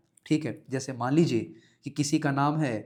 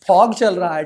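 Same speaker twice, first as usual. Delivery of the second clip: mocking quote; 2 semitones higher, 8 dB louder than usual. Mocking what everyone said.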